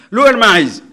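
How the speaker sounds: loud, high, sudden command; mock commanding guru. Teacher mimics shortcut guru passing energy, loud command. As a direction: order it like a showman guru.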